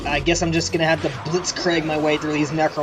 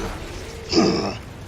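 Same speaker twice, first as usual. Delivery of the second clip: gruff soldier sigh; 10 semitones lower, 3 dB quieter than usual. Retold or imitated. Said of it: "horrible Solid Snake impression".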